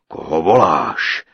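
loud, slow, low giant demand; suspicious giant. A narrator's reading. Giant questions the sighing princess, suspicious boom. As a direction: demand it in a booming drawl. Giant suspects someone is there.

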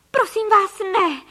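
high, loud desperate plea; cried out. A narrator's reading. Mother begging ducks not to shoot, crying out. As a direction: shriek a plea for mercy. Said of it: "vykřikla paní Frejková".

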